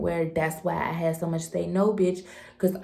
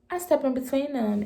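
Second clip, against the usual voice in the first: normal talking voice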